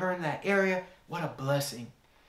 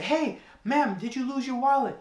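calling out; 8 semitones higher than usual. Retold or imitated. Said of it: "And then I asked her".

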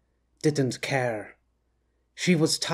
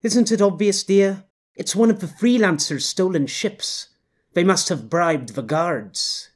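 higher, louder knowing wife voice; knowing woman; 4 semitones higher, 5 dB louder than usual. Acted. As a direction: talk down to him like a know-it-all wife. Woman character voice.

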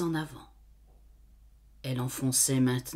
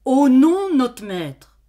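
anxious cry; 8 semitones higher, 11 dB louder, slower than usual. Acted. Maid cries her denial.

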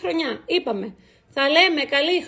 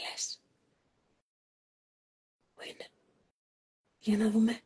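whisper; 5 semitones lower, 8 dB quieter than usual. Real, not acted. Waiting for friends to appear.

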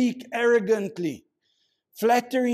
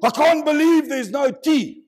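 louder, higher mock whine; hammed-up church moaner, louder and higher; complaining churchgoer; 8 semitones higher, 8 dB louder than usual. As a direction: grumble like a spoiled churchgoer.

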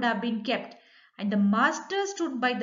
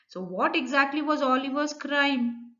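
ordinary talk

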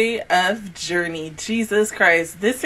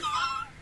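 sharp high gasp; shocked gasp; 14 dB quieter than usual. Retold or imitated. Reenacting shocked reaction.

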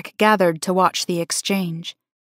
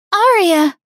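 high, loud call down the hallway; yelling a name; 12 semitones higher, 5 dB louder than usual. A narrator's reading. Friend calling after the Luna, loud call. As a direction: call out to stop her. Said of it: "she yelled out to me".

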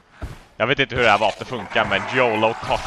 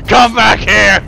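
high, loud yell after the enemy; loud chase yell; 8 semitones higher, 9 dB louder than usual. Real, not acted. Gamer chasing an enemy, high loud yell. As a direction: bellow at it to come back.

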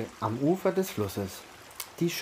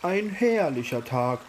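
turtle calling out, a bit higher and louder; cheerful call; 4 semitones higher, 4 dB louder than usual. A narrator's reading. Turtle arriving at the river to the friends, mild cheerful call. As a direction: call it out lightly, as in a children's story. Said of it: "rief die Schildkröte".

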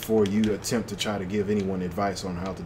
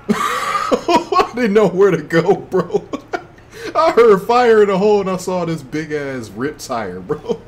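laughing; 10 semitones higher, 9 dB louder than usual. Real, not acted. Laughing after explosion.

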